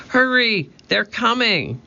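high-pitched warning call; urgent calling out. A narrator's reading. Annie urging Jack as pirates approach.

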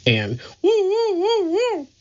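high hooting woo woo; funny woo sounds. Acted. Curly making his silly sounds, high hooting woos. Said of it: "He made funny sounds".

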